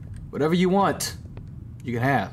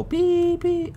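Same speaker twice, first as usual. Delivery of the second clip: much higher, mock electronic beeps; squeaky imitation of a game sound; imitated beeps. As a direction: chirp it like a machine. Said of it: "you just go".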